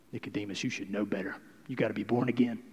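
a hint of low, soft scolding; low chiding, almost under the breath; Jesus gently chiding Nicodemus, lightly voiced; lightly voiced rebuke.

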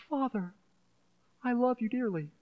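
put-on a child sweetly telling father he loves him; imitating a sweet child. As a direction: coo it like a child buttering up dad. Imitating his child's sweet talk.

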